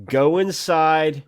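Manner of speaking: hammed-up public safety announcement to snake photographers; mock news bulletin. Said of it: "fucking breaking news here".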